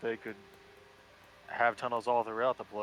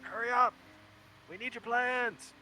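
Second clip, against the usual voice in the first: higher urgent call, slowing at end; 10 semitones higher than usual. Acted. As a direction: call out across the hall. Calling out to ally.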